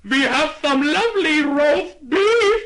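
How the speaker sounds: high, slow, loud shopkeeper call; drawn-out proud offer. Acted. Shopkeeper replies.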